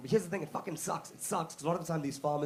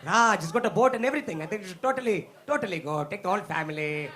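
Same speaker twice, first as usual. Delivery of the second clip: gullible excited Indians; 5 dB louder than usual. Retold or imitated. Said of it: "we were hanging out being like".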